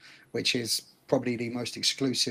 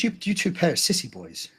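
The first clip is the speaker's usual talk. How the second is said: mock scolding; 5 semitones higher than usual. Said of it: "I said".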